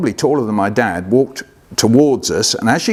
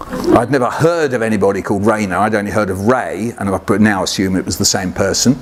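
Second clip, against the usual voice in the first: ordinary delivery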